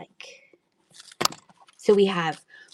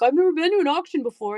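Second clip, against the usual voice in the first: clueless guy; 8 semitones higher, faster than usual. Retold or imitated. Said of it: "the guy's like".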